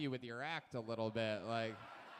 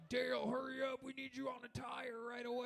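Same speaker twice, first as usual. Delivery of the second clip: mock yelling sergeant; 10 semitones higher than usual. Sergeant hollering at Dale about a flat tire. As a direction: yell orders at the mechanic, half laughing. Host mocks army commander yelling.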